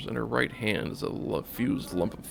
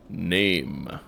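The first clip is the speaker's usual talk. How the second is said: one word, heavy and final like a bell; a touch of a tolling bell; 3 semitones lower, 4 dB louder than usual. Acted. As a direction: toll the word Name low and slow. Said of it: "it sounds like the tolling of a bell".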